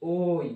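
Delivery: "ওই" as a drawn o gliding into i; oi diphthong. Shown as a demonstration. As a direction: model "ওই" as a long oh-ee. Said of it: "তুমি ওটাকে উচ্চারণ করবে".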